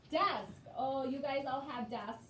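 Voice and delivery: usual speaking voice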